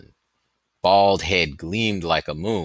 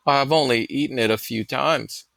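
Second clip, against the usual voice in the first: put-on young boy voice; 8 semitones higher than usual.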